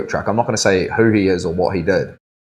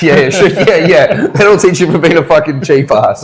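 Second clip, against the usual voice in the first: high, loud, mocking yeahs; sarcastic mock retort; 7 semitones higher, 5 dB louder than usual. Tattooist mocking a stingy customer, loud and sarcastic. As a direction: sneer at the cheapskate customer.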